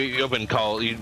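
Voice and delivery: usual speaking voice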